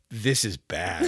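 slow, drawn-out verdict of a preschooler; imitating preschooler. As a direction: lightly mimic a small child, dragging the words out. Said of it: "the preschooler took a big gulp and goes".

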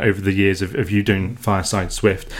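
ordinary delivery

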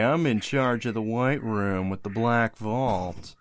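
William Donlow. Acted. Podcaster introducing Donlow's CIA job in a stuffy, snooty voice. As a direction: draw it out like a self-important stuffed shirt.

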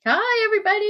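usual speaking voice

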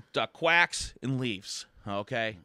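ordinary talk

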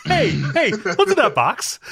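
slow, high, laughing through the quote; laughing movie quote. Retold or imitated. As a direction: quote it while cracking up. Repeating the Seven quote, laughing.

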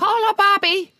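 jokey Cockney Call a Bobby; mock Cockney. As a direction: play a cheeky Cockney calling for police. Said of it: "your approximation of a Cockney accent".

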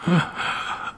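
long breathy sigh of emotion; emotional sigh. Real, not acted. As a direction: let out a moved sigh. Moved by a gift.

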